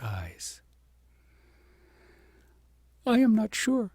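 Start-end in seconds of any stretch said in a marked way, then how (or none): 3.1-3.9 higher, hesitant and humble; a girl unsure of herself; humble princess. A narrator's reading. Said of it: "said the princess humbly".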